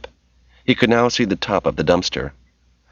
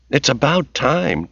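higher-pitched, drawn-out exasperation; irritable; 8 semitones higher, slower than usual. A narrator's reading. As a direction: stretch it out with mild irritation. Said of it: "Tracy said irritably".

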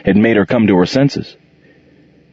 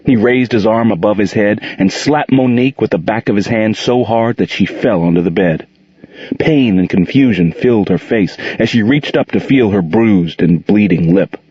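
ordinary delivery

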